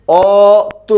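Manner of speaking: অত with a wide open অ, not ও. Shown as a demonstration. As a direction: say অত with the plain open অ. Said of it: "আর এটা উচ্চারণ করে দেখেন".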